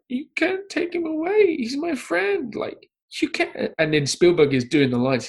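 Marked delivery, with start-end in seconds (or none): 0.1-3.7 put-on high, sobbing child's plea; crying kid. Podcaster imitating young Henry Thomas sobbing at his audition. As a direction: beg through tears not to lose your friend. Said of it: "And he's just like".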